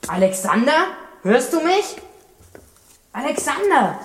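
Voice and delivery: high anxious calling out; calling from far away. Acted. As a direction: call into the dark for him. Calling lost friend in dark.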